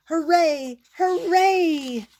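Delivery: high, loud hoorays; cheering. Acted. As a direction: cheer them on. Audience cheering.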